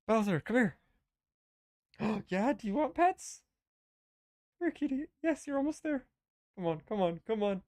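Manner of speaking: high sing-song coaxing a pet; calling a cat. Real, not acted. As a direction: coax the cat over, high and sweet. Calling the cat over for pets.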